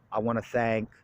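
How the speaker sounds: normal talking voice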